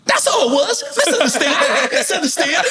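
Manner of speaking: high, mocking squeak of a scared man; mock-frightened yes-man, laughing; frightened promoter. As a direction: parrot it back nervously, with a laugh.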